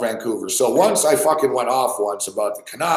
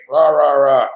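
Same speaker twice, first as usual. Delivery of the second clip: a hint of a louder mock cheer from a caller; mock cheerleader; 5 dB louder than usual. Host imitating cheerful caller to listeners, mock rah-rah cheer. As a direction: chant it like a sarcastic pep rally.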